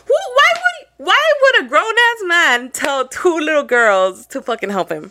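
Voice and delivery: high, loud, drawn-out disbelief; indignant and pitched right up; incredulous outburst. Real, not acted. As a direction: burst out at how absurd it is, stretching it out. Incredulous aside about the man's request.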